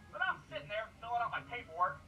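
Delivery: ordinary talk, nothing marked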